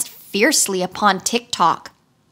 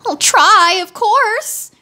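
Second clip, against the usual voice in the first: high, loud eager reply; eager wheeler; 11 semitones higher, 5 dB louder, slower than usual. A narrator's reading. Wheeler eagerly agrees to Tick-Tock, high. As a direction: jump at the offer. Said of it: "replied the wheeler eagerly".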